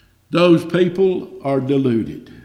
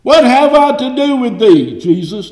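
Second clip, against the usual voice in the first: loud cry; 8 semitones higher, 7 dB louder than usual. Acted. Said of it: "cried with a loud voice".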